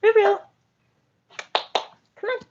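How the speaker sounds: high sing-song call; pet call. Real, not acted. Calling the pet Ruben to eat. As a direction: coo the name up high.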